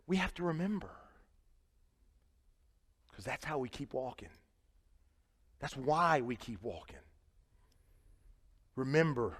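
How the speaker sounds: hushed after the climax; quiet reflection. Acted. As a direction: pull the room in close.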